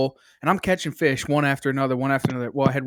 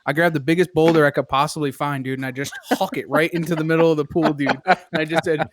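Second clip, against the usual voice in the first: ordinary delivery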